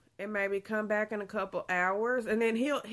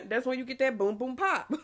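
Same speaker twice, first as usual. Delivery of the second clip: louder, laughing through it; 5 semitones higher, 5 dB louder than usual. Real, not acted. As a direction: laugh out the words. Laughing at kicks.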